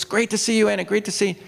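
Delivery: high, teasing echo of a fan; mock gushing. Bush teasingly echoes the fan's greeting, high and mock-gushing.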